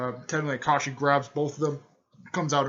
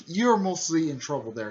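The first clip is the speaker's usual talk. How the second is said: raised, louder mock warning; hard scolding; 5 semitones higher, 5 dB louder than usual. Older sister telling Naruto off, imitated scolding. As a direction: imitate a sister laying down the law. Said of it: "was scolding Naruto pretty hard".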